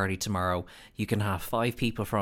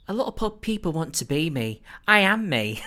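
imitating a housewife tagline; 7 semitones higher, 4 dB louder than usual. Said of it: "someone else who goes".

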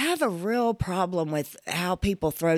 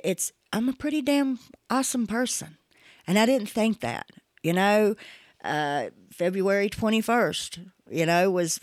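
ordinary delivery

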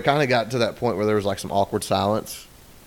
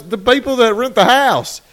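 much higher, louder whiny answer; mock husband explaining; 12 semitones higher, 7 dB louder than usual. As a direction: whine it back at her.

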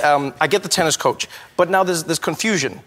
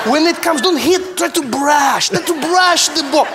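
raised, pleading instruction; high, insistent coach; 9 semitones higher than usual. Retold or imitated. The coach keeps begging Trevor to brush the ball.

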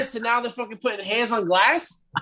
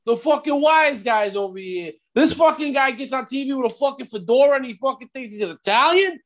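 mock Brooklyn wise guy; 3 semitones higher than usual. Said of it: "as an Italian American myself".

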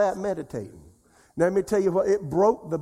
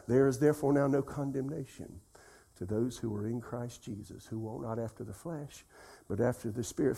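lower, softer recital trailing down; meditative repetition; 3 semitones lower, 6 dB quieter than usual. Shown as a demonstration. Preacher meditating a verse said fifty times, low soft recitation. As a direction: murmur the familiar verse to yourself. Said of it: "I've said it over fifty times".

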